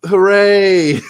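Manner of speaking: sarcastic mock cheer, ending in a high, loud "Hooray". Guest mocks the crowd's delight with a high, loud cheer. Mocking the public's reaction.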